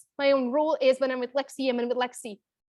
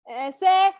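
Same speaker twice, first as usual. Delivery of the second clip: a hint of a toddler asking, pitched higher and louder; 6 semitones higher, 6 dB louder than usual. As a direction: lightly voice the daughter holding up clothes and asking. Toddler rejecting clothes.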